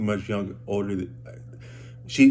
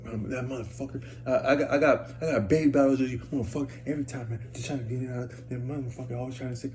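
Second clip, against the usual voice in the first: imitated woman muttering curses, higher and soft; quiet, pitched-up mock ranting to herself; grumbling woman; 3 semitones higher, 4 dB quieter than usual. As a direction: grumble under your breath as her. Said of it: "But then she go up to a lottery ticket".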